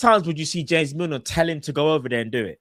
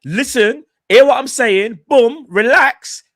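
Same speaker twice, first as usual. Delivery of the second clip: raised, emphatic retelling of a coach; retold coach's pep talk; 5 semitones higher, 6 dB louder, slower than usual. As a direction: re-enact the coach, loud and higher, drawing out the last words. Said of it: "And then Klopp saying".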